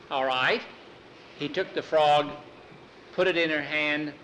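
ordinary delivery, nothing marked

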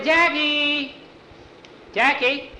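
high, loud calls across the water; shouting a name; calling out. Acted. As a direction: call out to find a lost friend. Calling for missing friend.

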